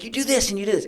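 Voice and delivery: impatient boss lecturing team; frustrated. Retold or imitated. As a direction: spell it out with exasperation. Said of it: "I'm frustrated and I'm mad".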